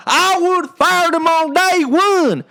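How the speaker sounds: high, slow whiny critic; mocking voter. Mocking voters who say they'd have fired him, high and slow. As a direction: squeak it out like a know-it-all voter. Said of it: "They're like".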